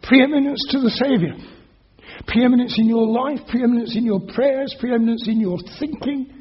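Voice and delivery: sermon climax, building list; ringing proclamation. Acted. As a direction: drive each "preeminence" home. Preacher's rhetorical climax.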